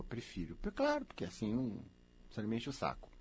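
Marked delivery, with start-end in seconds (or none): 0.0-0.6 unvoiced "prefiro"; whispered aside. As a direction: whisper "prefiro" under your breath. Resposta sussurrada. ("prefiro" sussurrado)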